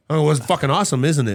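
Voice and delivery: hammed-up proud boast; mock pride. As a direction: boast it out, oblivious. Retold boast to coworkers.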